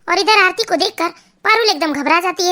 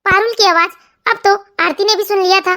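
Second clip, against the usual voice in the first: usual speaking voice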